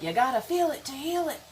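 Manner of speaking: high, sing-out emphatic slogan; playful slogan. Pretend. Repeats slogan playfully, then says sorry.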